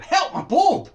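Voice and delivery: "Help my bob!" yelled very loud and high; "Help my bob!" as a piercing shriek. Shown as a demonstration. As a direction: scream "Help my bob!" in fright. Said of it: "sees the spider".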